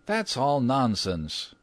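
slow, high-pitched brash exclamation; acted scoffing young man's outburst; dismissive cry. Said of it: "cried Washington Otis".

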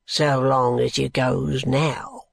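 low, slow, sullen working man; grudging reply, dragged out low; surly, drawn-out dismissal; morose brickmaker. Acted. Morose brickmaker answers the lady.

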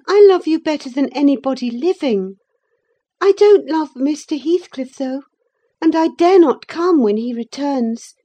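put-on high, loud girl's voice; earnest young Catherine. A narrator's reading. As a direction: protest affection in a high, emphatic voice.

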